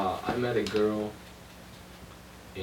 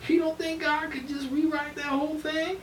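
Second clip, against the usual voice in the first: much higher, incredulous Southern voice; mocking the woman's disbelief in a high Southern accent; imitating her accent. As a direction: squeal it back as if the Bible were nothing. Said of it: "You got the accent and everything".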